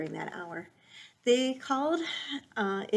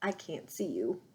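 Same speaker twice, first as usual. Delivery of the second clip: low croaky frog voice; croaking frog; 8 semitones lower, 4 dB quieter than usual. Acted. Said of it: "One would croak".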